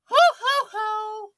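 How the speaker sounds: high ho ho ho; jolly Santa laugh; mock Santa laugh. Santa in comic.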